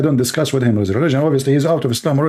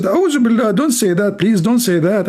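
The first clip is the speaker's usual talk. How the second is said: pleading friend; 8 semitones higher than usual. Retold or imitated. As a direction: beg him high to stop. Friend begs after pig insult.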